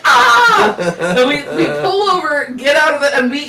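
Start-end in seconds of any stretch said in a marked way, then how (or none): 0.0-0.8 loud high squeal; mock spider scream. Voicing the spider's own scream in the car story. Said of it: "the spider's like".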